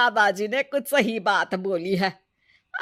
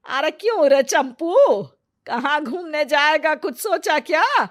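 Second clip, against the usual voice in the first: higher-pitched, prodding elder; nagging grandmother; 6 semitones higher, 9 dB louder than usual. Acted. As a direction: needle the grandson for an answer. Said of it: "दादी अगली सुबह एक बार फिर दोनों को टोकती है". (टोकती हुई दादी)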